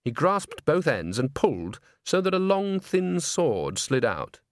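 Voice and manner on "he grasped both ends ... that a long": plain reading voice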